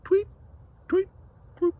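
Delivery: tiny, short high chirps; bird tweets. A narrator's reading. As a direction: peep like a little bird, spaced out. Bird noises after story ends.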